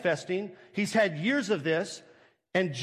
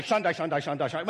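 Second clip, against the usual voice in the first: rapid-fire chant, imitated; mock praying in tongues; 5 semitones lower, faster than usual. Church praying over the boy, mock tongues. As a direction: rattle it off like an overzealous deliverance prayer. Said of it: "We'd be Shandaiing that boy".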